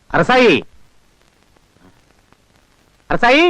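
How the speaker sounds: high, carrying call of a name. Acted. A worried father calls out for his missing daughter. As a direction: call her name far across the fields.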